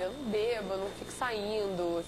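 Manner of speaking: ordinary talk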